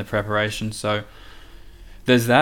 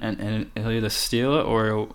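ordinary talk

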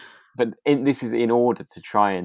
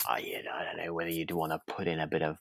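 whisper; 3 semitones lower, 9 dB quieter than usual. Real, not acted. Aside about adding flour.

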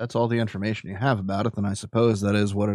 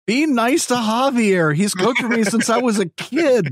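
in-character plea; 12 semitones higher, 4 dB louder than usual. Acted. In-character son defending cook.

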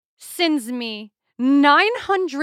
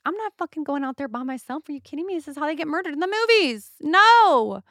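rising, half-panicked refusal getting higher toward the final No; scared refusal; 5 semitones higher than usual. Retold or imitated. Refusing to go out at night.

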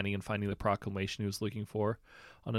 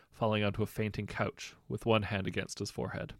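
ordinary delivery, nothing marked

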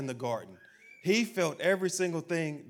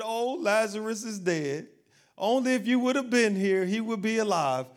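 mock crying plea; 6 semitones higher than usual. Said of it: "When they came crying to him".

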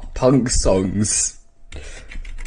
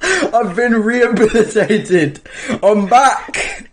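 mock Jesus, laughing; 10 semitones higher, slower than usual. Friend continues the Jesus joke, high and laughing. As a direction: ham it up through laughter.

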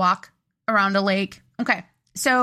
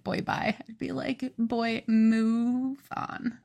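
cheesy joke; 7 dB quieter, much slower than usual. Acted. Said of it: "do a cheesy joke".

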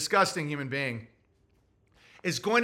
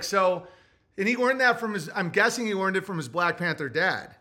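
ordinary talk, nothing marked

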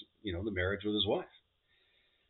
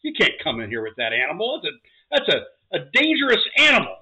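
louder, higher warning bartender; 7 semitones higher, 9 dB louder than usual. Acted. Bartender stopping a man with an alligator, raised warning. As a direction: warn him off, raised voice. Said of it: "the bartender says".

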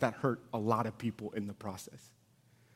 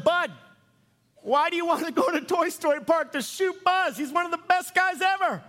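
high, loud, hammed-up protest; mock incredulous yell; 5 dB louder than usual. Dad questioning his son about shooting Buzz, incredulous yelling. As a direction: shout it in comic disbelief, voice way up. Acting out dad's shocked reply.

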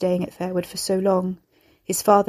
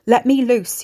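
higher, loud demand to be freed; raised, desperate yell; shouted; 5 semitones higher, 7 dB louder than usual. A narrator's reading. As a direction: shout it urgently at your captor. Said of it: "he shouted".

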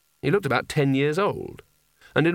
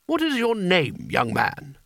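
angry; 5 semitones higher, slower than usual. A narrator's reading. Spelter demands angrily.

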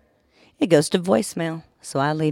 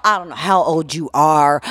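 loud retold rant at the scammer; angry phone tirade; 7 semitones higher, 10 dB louder, slower than usual. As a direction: tear into him at high volume. Acting out her furious phone tirade.

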